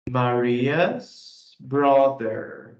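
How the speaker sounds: very slow, drawn-out statement. Shown as a demonstration. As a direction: state it slowly and clearly. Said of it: "eu fiz uma afirmação". (afirmação bem lenta)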